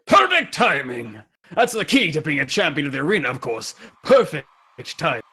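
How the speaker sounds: lightly boastful warrior voice; hearty old champion. Acted. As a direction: savor it like an arena champion. Champion Ramses speaks.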